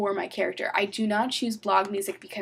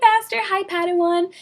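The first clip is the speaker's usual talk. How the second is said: playful greeting; 10 semitones higher, 8 dB louder than usual. Retold or imitated. Greeting quoted from their private chats.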